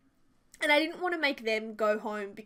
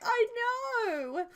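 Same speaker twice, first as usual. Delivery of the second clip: sheepish agreement; 11 semitones higher than usual. Retold or imitated. Agreeing with husband.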